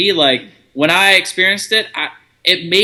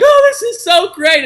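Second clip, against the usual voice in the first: gushing; 4 dB louder than usual. Retold or imitated. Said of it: "we'd be like".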